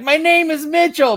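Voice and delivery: the player insisting on his name; mock prissy insistence. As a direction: chirp the name out primly. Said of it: "Mitchell being like".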